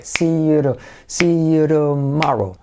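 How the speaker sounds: slow "see you tomorrow" as "siyu-ro-MA-ro"; drawn-out linking with a soft R in "see you tomorrow". Shown as a demonstration. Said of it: "hacer la T como una R suave".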